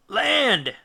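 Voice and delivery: a loud, high call of land sighted; shouted. Acted. Lookout spotting land, shouting to the crew. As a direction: cry it out from the masthead. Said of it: "shouted the lookout".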